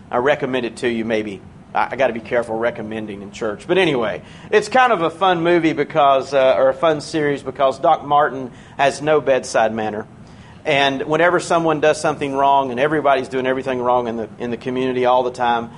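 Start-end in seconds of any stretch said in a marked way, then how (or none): none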